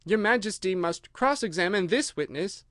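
ordinary delivery, nothing marked

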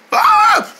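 very high-pitched shriek; sudden piercing squeal in place of an answer. Acted. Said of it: "Why you keep shrieking like that".

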